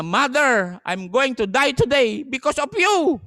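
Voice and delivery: loud, high, bitter blame; loud accusing son. Retold or imitated. Condemned son blaming his mother, loud and bitter.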